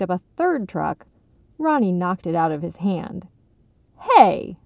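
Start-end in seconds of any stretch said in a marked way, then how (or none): 4.0-4.6 loud indignant shout; brief, loud objection. A narrator's reading. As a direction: call out in protest. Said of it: "Jake shouted".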